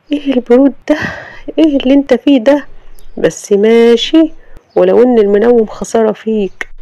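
wife rebuking the husband for banging on her door; indignant Sama. Acted. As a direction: snap back at the rude husband.

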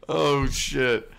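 usual speaking voice

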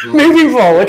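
moving forward said through a laugh, high. Real, not acted. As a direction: laugh it off while changing topic. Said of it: "But never mind".

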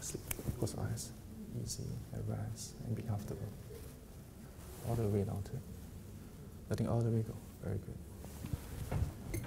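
very soft, hushed hypnotist voice; hypnotic induction. Real, not acted. Hypnotist easing a volunteer into rest, very soft and soothing. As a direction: lull them down in small, soft phrases.

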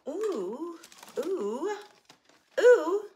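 rising, delighted ooh; building oohs of delight. Real, not acted. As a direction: coo over the find. Admiring a new shape.

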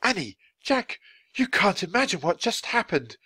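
high-pitched, agitated amazement; shaken excitement. A narrator's reading. Writer greeting the children after visions, shaken. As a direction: greet them, bursting with news. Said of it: "His voice was shaking".

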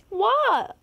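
high startled what; stunned by a sudden fall; high indignant what; shocked what. Real, not acted. Sudden fail in game.

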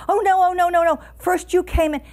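high, loud storybook panic; hammed-up frightened cry, pitched well up; screaming. A narrator's reading. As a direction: wail in feigned alarm as a ruse. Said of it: "suddenly began to scream".

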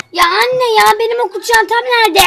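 whiny complaint. Acted. Daughter whining to mum about her lost school bag, high. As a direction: complain like a spoiled kid.

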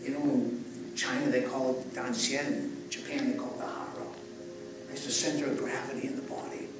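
soft, hushed breathing-exercise guidance; guided breathwork. Acted. Guiding the group through breathing, soft and hushed. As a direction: talk them gently down into the belly.

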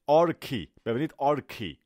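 آرکی with a hard k sound; K pronunciation. Shown as a demonstration. Teacher showing students how the word begins, correct K pronunciation. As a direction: punch out آرکی, k not ch. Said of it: "تلفظش که هستش".

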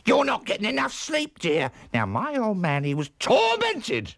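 high, swooping character voice with squeals; chatty, solicitous housekeeper. Acted. Missus E advising the aunt about sleep, chatty high voice. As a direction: gossip on in a high, lurching voice. Plays Missus E, story figure.

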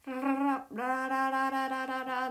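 ラララ with a trilled rolled r. Shown as a demonstration. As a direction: roll the r in ラララ, tongue fluttering. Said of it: "なんか練習した".

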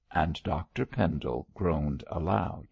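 plain reading voice

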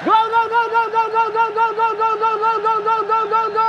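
long high screaming goal call; touchdown call. Real, not acted. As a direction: scream gol again and again. Goal call, hat-trick.

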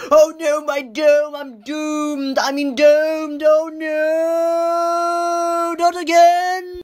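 hammed-up dismay. Acted dismay at losing dome.

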